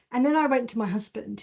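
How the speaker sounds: ordinary talk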